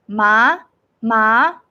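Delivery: "Ma" sliding up like a question; second tone, rising. Shown as a demonstration. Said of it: "the second tone going up".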